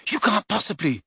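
high, shocked protest. A narrator's reading. Hermione protests the door-blasting, shocked. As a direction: object in disbelief, pitch jumping up. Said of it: "sounding shocked".

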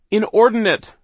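high, very loud shout. A narrator's reading. Sister shouts over the saw to free brother, full shout. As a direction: yell it over the noise. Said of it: "she shouted, as loudly as she could".

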